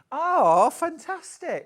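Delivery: high, loud burst of delight; really thrilled by the news. Real, not acted. Host thrilled that a kit goes to Australia. Reacts to viewer's message.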